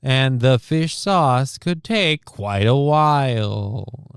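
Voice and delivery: slow, drawn-out news anchor cadence; weird news cadence. Retold or imitated. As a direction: mimic her stiff reporter read. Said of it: "she had this weird cadence of".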